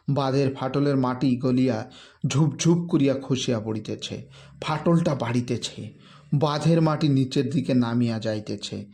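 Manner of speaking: ordinary delivery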